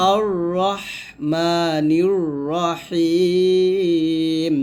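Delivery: slow chant with h pressed in "রহিম"; drawn-out recitation, heavy r and pressed h in "রহিম"; pressed throat h. Shown as a demonstration. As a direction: recite "রহমানির রহিম" squeezing the h, last vowel held long. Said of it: "হাটা চাপ দিয়া".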